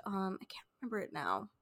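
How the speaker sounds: breathy whisper to herself; whispered aside. Real, not acted. Trying to recall the other Mike Leigh film, whispering. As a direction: drop to a whisper, searching her memory. Forgetting a film title mid-thought.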